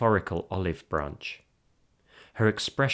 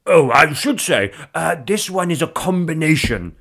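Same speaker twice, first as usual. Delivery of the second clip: loud, high explanatory interjection; showman's aside; 6 semitones higher, 7 dB louder than usual. Acted. Husband interrupting his bravissimo performance, loud showman's aside. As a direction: break off and announce it loudly.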